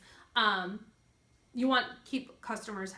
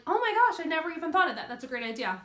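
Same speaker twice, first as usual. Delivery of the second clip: excited gasp; 6 semitones higher, 7 dB louder, faster than usual. Retold or imitated. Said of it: "when I tell people, like".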